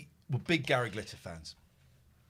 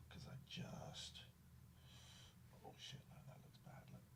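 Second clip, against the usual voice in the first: whisper; 24 dB quieter than usual. Real, not acted. Checking the cat's wound quietly.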